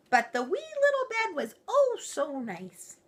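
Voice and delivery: high, cooing wee little voice; high sweet storybook. A narrator's reading. Narrator cooing over the wee little bed, high and sweet. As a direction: coo it like something tiny and lovely.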